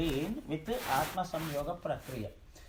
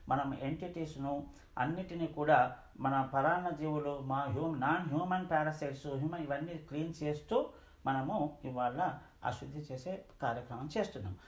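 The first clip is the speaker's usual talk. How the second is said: ordinary delivery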